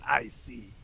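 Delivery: breathy knowing whisper. Acted. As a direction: whisper it to yourself. Host aside.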